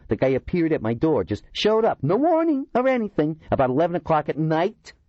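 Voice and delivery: a complaining oddball telling his grievance; aggrieved Kurtzima. A narrator's reading. Kurtzima recounts the stranger's visit.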